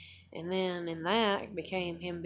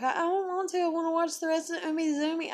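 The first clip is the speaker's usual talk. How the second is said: put-on high whiny little kid; whiny child; 10 semitones higher, faster than usual. Small daughter whining to her mom, much higher. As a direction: whine like a kid who won't bathe. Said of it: "She'd be like".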